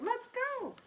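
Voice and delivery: high, urging call of let's go; calling the dog. Retold or imitated. Retelling tugging the frozen dog on the walk, high urging call. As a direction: coax the stubborn dog to move. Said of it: "I'm like".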